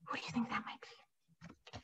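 softly whispered question. Real, not acted. Teacher whispering the riddle to the children, hushed. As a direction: hush it like a secret.

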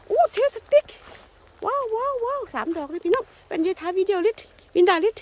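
high, loud burst of wows; excited delight. Real, not acted. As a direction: exclaim in real delight at a lucky find. Delighted at finding three mushrooms. (ตื่นเต้นดีใจ เสียงสูง)

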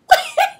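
sudden high laugh; loud burst of laughter. Real, not acted. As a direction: crack up loudly. Laughs between the repeated line.

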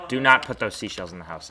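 shouting a warning; loud scolding. Real, not acted. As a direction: yell it at your sister. Scolding sibling loudly.

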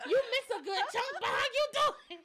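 loud, high and laughing; excited greeting. Real, not acted. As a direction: shout a thrilled welcome through laughter. Excitedly greeting second viewer through laughter.

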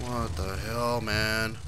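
ordinary talk

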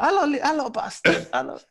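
high, light mock Italian greeting; a quick tease in Paolo's accent; Italian accent impression. Teammate replaying Paolo's greeting, light mock Italian accent. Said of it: "in his accent".

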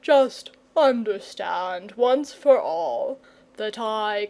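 slow, grave dragon voice, firmly refusing; a lazy dragon drawing the line; stubborn dragon. Acted. Dragon declares he won't fight.

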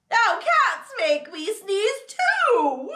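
high, slow, acted wail; exasperated despair. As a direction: draw it out in dismay. Gerald despairs after another sneeze.

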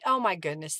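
ordinary talk

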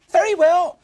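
very high, loud piggy reply; little pig voice. Acted.

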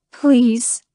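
breathy, pleading please; begging. A narrator's reading. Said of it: "I begged again".

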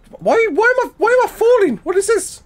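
very high, loud squeal of alarm; real panicked yelp. Real, not acted. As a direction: blurt it out in sudden panic. Suddenly falling in the game.

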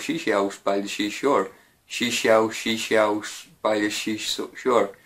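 slow, slurring She sells into shaws over and over; fumbling tongue twister. Shown as a demonstration. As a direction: stumble through the twister, laughing at slips. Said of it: "Can you say seashells".

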